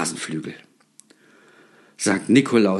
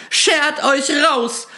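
much higher, slow, drawn-out screech; shrieking even higher; 3 dB louder, much slower than usual. A narrator's reading. Terrified shopkeeper screeches again to drive them out. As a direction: push the order out at the top of the voice. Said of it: "schrie sie auf einmal".

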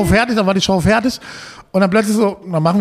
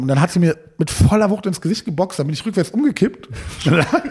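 usual speaking voice

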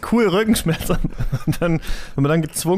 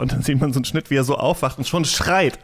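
ordinary talk, nothing marked

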